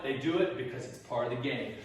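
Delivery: ordinary talk, nothing marked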